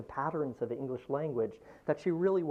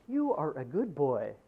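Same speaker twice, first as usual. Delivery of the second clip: put-on slow, even voice saying good boy; a calm video voice praising the boy; slower than usual. Video artist's voice to the viewer, slow and measured. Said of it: "this person over here is saying".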